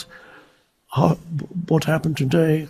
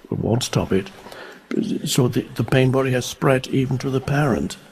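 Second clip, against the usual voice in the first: ordinary talk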